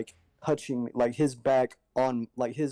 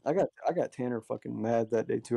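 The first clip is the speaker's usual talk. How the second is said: normal talking voice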